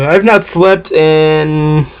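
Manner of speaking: slow, yawning words. Real, not acted. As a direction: talk through the end of a yawn. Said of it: "excuse me as I yawn".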